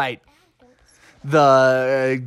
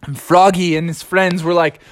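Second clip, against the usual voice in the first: ordinary delivery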